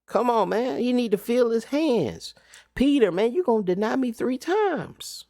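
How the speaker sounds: high, urging chiding voice, lightly acted; voicing Christ. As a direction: urge them like a teacher losing patience.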